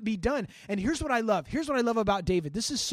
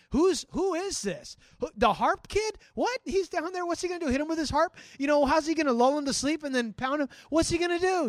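scoffing crowd voices, pitched up and louder; mock disbelief, a notch louder; mocking onlookers; 5 semitones higher, 4 dB louder than usual. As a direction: sneer at the harp kid like doubters in the camp. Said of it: "there's commotion".

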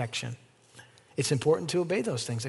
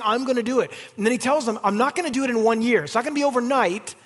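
high, loud, revved-up preaching; climbing to a near-shout over the hornets; fiery preaching; 8 semitones higher, 7 dB louder than usual. Acted. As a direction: preach it hard to the congregation. Preaching at full force.

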